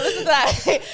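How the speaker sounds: laughing while telling parents to listen. Retold or imitated. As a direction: giggle out the invitation.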